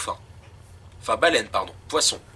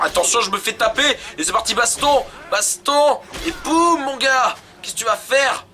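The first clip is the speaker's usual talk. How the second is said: excited battle cries; 7 semitones higher than usual. Real, not acted. Player hit mid-explanation launches into a fight, excited battle cries. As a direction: voice climbing high, fired up.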